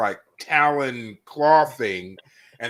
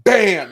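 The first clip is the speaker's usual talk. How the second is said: shouted bam, much higher and louder; loud bam sound; 10 semitones higher, 10 dB louder than usual. Retold or imitated. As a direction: slam it out like a gavel strike. Imitating the gavel slam.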